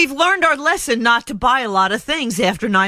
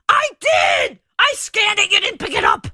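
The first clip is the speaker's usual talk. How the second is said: yelling; 9 semitones higher than usual. Retold or imitated. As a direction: yell it high and frustrated. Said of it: "I'm yelling at it going".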